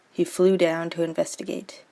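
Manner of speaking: normal talking voice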